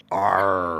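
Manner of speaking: low, drawn-out pirate noise; mock pirate arr; pirate growl. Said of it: "he's gonna be a pirate".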